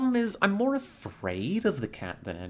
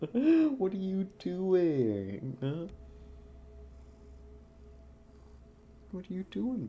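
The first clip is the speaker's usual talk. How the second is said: higher, slow sing-song pet voice, softer; 7 semitones higher, 4 dB quieter than usual. Real, not acted. Streamer cooing at her cat in baby talk.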